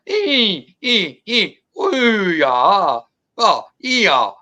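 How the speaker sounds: high, loud squeals falling into long yaws; imitated creature screaming. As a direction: mimic the second creature answering back. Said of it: "the other one would go".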